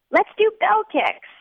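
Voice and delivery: put-on high, slow drunken glee. A narrator's reading. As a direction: announce it like a giddy drunk. Drunk narrator's brilliant idea.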